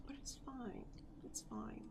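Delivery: hushed, resigned to yourself; whispering. Real, not acted. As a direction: breathe it out softly. Resigned quiet reassurance to self.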